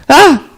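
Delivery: very high, loud mock shriek; sudden acted cry of shock; shocked yelp. Gopi discovering her own son, startled shriek.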